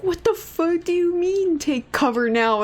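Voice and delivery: high, slow incredulous outburst; disbelief. Retold or imitated. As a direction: stretch it out in disbelief. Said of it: "And I was like".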